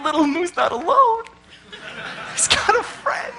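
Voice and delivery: high, laughing, charmed; delighted laugh. Retold or imitated. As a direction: giggle as you say it, rising high. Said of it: "you're like".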